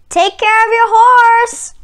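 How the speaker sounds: loud, high call into the night; calling after him. Acted. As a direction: shout after the departing rider. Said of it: "Hangan called after him".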